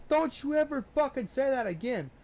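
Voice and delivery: high, forceful rebuke; retold angry warning. Telling off someone who used a slur, angry warning.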